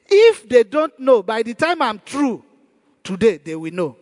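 ordinary talk

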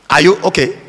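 ordinary delivery

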